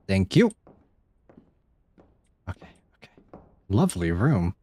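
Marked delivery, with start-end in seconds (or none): 2.5-3.1 breathless whisper so it won't hear. Real, not acted. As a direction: hush it while hiding. Hiding from monster, whispering.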